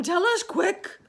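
high, loud and urgent; eager cry. A narrator's reading. Earthworm urging James. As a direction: demand it now. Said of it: "cried the earthworm".

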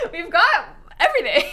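real amused laughter breaking up the line; laughing. Real, not acted. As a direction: crack up while saying it. Cracks up listing the pools.

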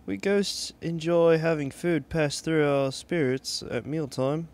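ghost with a put-on British accent; playful British character accent. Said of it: "giving them a British accent".

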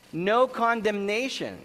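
NO CONDEMNATION proclaimed high and loud; emphatic declaration. Shown as a demonstration. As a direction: preach NO CONDEMNATION with full emphasis. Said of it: "an exaggeration".

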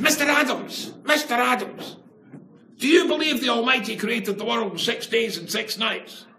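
put-on squeaky teen nerd putting on innocence; nerdish voice. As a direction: play the naive nerd asking innocently. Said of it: "I used my most nerdish voice".

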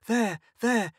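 high, teary comforting; sobbing. A narrator's reading. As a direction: soothe through sobs. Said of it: "sobbing against the door".

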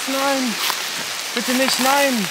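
loud desperate no; despairing cry. Real, not acted. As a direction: cry out as the rain pours in. Gear getting soaked in rain. (verzweifelter Ausruf)

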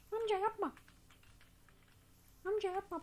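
high, whiny mock plea; mock begging. Gamer pleading with an attacking old man, high whine. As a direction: beg him to stop, half joking.

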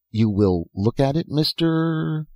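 mildly eager, last word drawn out high; coax, then leave the name hanging questioningly. A narrator's reading. Old professor asking Dan to try it, eager. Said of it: "Eagerness crept into his voice".

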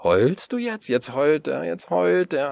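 loud, high taunting sing-song; man jeering at the crying boy, loud and high; mocking jeer. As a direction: rub it in, slapping your thigh.

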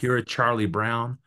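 ordinary talk, nothing marked

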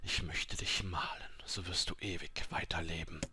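soft, half-whispered offer; ghostly apothecary. A narrator's reading. Ghostly apothecary offering to paint the dying woman, soft whisper. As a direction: murmur it softly like a ghost.

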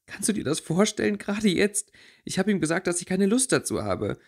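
empört und viel höher; zornig. Acted. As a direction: lass den Ärger über den Freund raus. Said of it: "durchzog nun auch die Zorn". (Winona erzählt Theresa empört von Merton, deutlich höher)

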